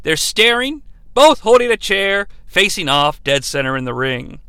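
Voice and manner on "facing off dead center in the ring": ordinary talk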